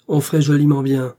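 a loud grumbling retort; growling. A narrator's reading. Grumpy old gentleman retorting from behind his newspaper, loud and cross. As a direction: bark it back, grumpily. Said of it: "gronda monsieur de Grosmont".

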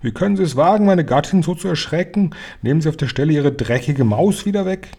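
high-pitched outraged yelling; threatening shout. A narrator's reading. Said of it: "baute sich drohend vor uns auf und schrie". (drohend und empört geschrien)

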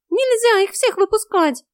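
high, loud, slow cat wail; stretched high-pitched yell; alarmed cat. A narrator's reading. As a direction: howl a warning as a cat.

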